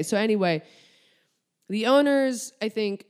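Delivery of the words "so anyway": ordinary talk, nothing marked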